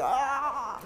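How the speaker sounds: mock shriek. Imitates monster in box.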